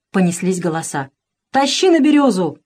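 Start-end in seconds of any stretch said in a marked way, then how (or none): 1.5-2.6 command. Acted. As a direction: order the Cossacks to hang him. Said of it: "скомандовал Разин".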